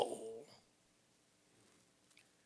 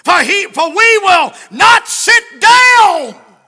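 very loud, high, slow preacher's cry; preaching at full force; 8 semitones higher, 15 dB louder than usual. Acted. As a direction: stretch each word out to the church. Preached scripture and Jesse's excuse.

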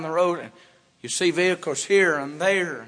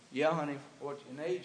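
soft father voice; 3 semitones lower, 10 dB quieter, faster than usual. Acted. Father answers daughter softly.

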